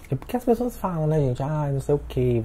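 ordinary delivery

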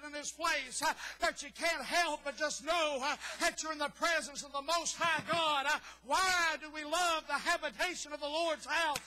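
loud revival preaching, slowing at the end; fervent preaching. Acted. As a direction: preach it with rising fervour. Preacher building on God's presence.

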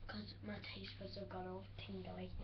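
soft whispered aside; whispering. Real, not acted. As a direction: whisper it to the side. Quiet aside to sister.

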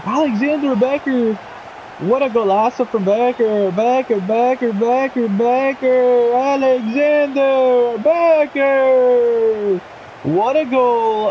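high, elated chant of the scorer's name; commentator-style celebration. Real, not acted. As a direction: repeat the name, stretching the last one long. Chanting scorer's name after goal.

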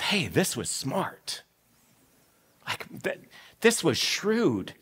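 slow, soft admiration; acted boss giving slow, hushed approval; impressed boss. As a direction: weigh each word, quietly impressed. Boss voiced, impressed by the trick.

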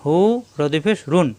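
normal talking voice

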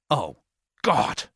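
angry outburst. Acted. John reacts to the danger to Lorna, angrily. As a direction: burst out in anger. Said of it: "the anger in my voice frightened Lorna".

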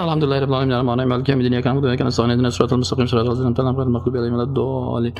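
racing through the Fatiha in one breath; rushed recitation. Shown as a demonstration. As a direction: gabble it all without stopping.

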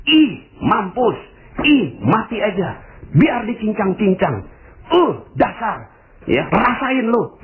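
high, drawn-out mock cursing; gloating condemnation. TV viewer jeers at an arrested corruptor, gloating. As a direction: spit out high-pitched jeers, acting a scornful viewer. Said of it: "terus kemudian Anda katakan begini".

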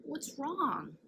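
kindly asked. Acted. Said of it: "kindly asked him".

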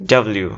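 "ডাব্লিউ" in one quick run, dab-liu; quick pronunciation. Shown as a demonstration. Teacher showing it is said very fast, quick pronunciation. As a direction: run the syllables of "ডাব্লিউ" together briskly. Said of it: "খুব দ্রুত উচ্চারণ করবে".

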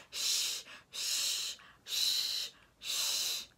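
repeated hushing "shh"; imitated shushing. Wife shushing husband like a child, imitated shushing. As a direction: shush someone like a child, over and over. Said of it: "literally shushing him like a child".

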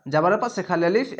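usual speaking voice

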